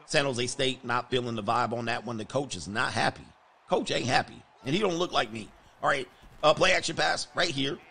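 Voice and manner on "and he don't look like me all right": ordinary delivery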